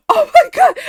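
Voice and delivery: very high, loud squeal; shocked outburst. Retold or imitated. Seeing worker hold the Gojo card, shocked squeal.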